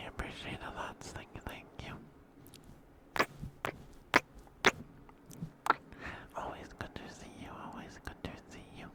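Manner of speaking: soft unvoiced ASMR whisper, very close. Real, not acted. Thanking viewer Mooney on stream, soft ASMR whisper. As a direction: breathe the words gently to the listener. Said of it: "your whispers and then some tapping for ya".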